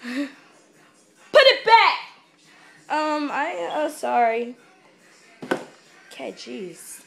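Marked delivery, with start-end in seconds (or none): 0.0-2.0 sharp loud high yell; shout. Real, not acted. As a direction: snap it at them. Yells at someone.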